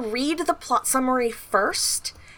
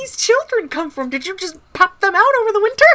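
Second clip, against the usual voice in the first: high-pitched, exaggerated disbelief; mock incredulous; 11 semitones higher, 4 dB louder, faster than usual. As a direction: demand an answer in comic, high-voiced bafflement. Mock questioning of the author.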